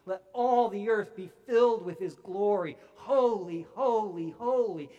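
loud, soaring cry of holy; angels crying out. Retold or imitated. Preacher voicing angels crying out to God, loud proclaiming. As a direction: proclaim it like angels calling to each other. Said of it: "angels cover their faces and cry out".

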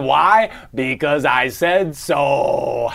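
normal talking voice

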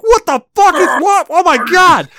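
high, panicked squeal; mock terrified shriek; retold panic. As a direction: shriek like he just burst through the wall. Retold panic, boss through wall.

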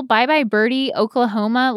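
usual speaking voice

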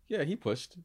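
mock shrug. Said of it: "but Brian claims".

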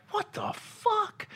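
very high, slow, softened exclamation; drawn-out high, quiet mock bewilderment; baffled disbelief. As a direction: squeak it out slowly in disbelief. Baffled self-talk acted out.

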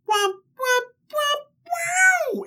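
high imitated instrument wah-wah; high mock-music wail. Comic storyteller covering the wedding night, mock musical womps. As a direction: sing out silly high womps.

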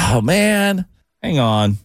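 normal talking voice

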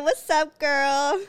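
giddy host greeting a listener; excited greeting. Real, not acted. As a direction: hype her up. Greeting caller on the phone.

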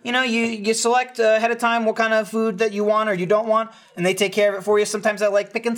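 ordinary talk

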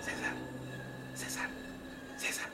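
hushed, voiceless calling of a name; a scared boy whispering to his sleeping brother. Retold or imitated. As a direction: whisper the name to wake him without noise.

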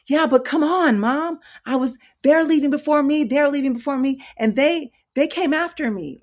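high, loud mock complaining; imitated frustrated protest. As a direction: protest to mom, mimicking an impatient son. Voices frustrated son protesting.

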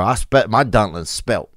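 ordinary talk

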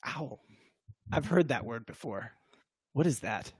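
low, soft imitation; imitated puzzled player. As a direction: mull it over quietly as the baffled guy. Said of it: "He's like".

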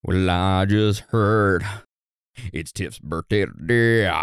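slow, hammy rock-singer drawl; [name] style. Pretend. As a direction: croon it like a grunge frontman. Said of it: "happy birthday in [name] style".

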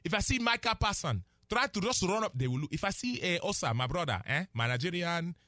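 put-on emphatic Nigerian accent, rising then dropping. Analyst warning the Cowboys against selfishness, in Nigerian accent. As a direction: lecture them in a Nigerian accent. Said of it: "the accent".

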